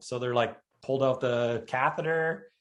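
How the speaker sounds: ordinary talk, nothing marked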